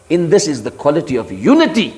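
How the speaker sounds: normal talking voice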